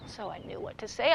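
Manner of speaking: usual speaking voice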